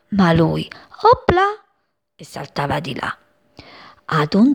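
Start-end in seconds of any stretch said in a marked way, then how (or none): 1.0-1.4 high playful hop sound; very high chirpy opla; chick opla. Acted. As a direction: toss it off like a leap. Chick jumps.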